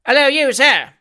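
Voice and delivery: loud, high, fierce hail across the court; fierce shout. Acted. Sam hails the stranger up the court, fierce shout. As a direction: bark the greeting to stop a stranger. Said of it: "shouted Sam fiercely".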